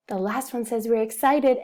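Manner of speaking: usual speaking voice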